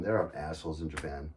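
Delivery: usual speaking voice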